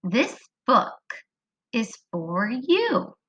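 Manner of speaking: slow, clear read-aloud; slow modeled reading. Shown as a demonstration. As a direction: read it so the kids can follow. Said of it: "It says".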